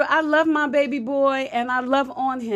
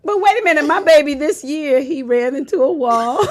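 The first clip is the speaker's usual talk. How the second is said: normal talking voice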